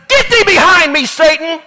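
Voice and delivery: high, loud stern command; forceful rebuke. Acted. Jesus rebuking Peter, loud and stern. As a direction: drive Satan off with authority. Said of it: "Jesus turned around and said".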